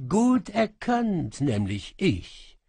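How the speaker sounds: high, slow, gloating cat voice; smug cat djinn. Acted. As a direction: savour the reveal.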